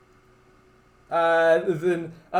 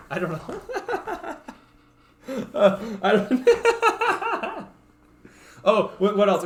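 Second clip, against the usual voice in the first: ordinary talk, nothing marked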